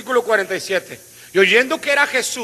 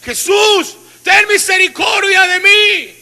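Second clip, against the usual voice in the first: shouting; 11 semitones higher, 9 dB louder, slower than usual. Acted. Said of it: "Empezó a gritar".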